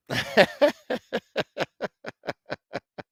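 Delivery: long, high trailing laugh; helpless chuckling that dies away; real drawn-out laughing fit. Real, not acted. Laughs after self-reflective remark.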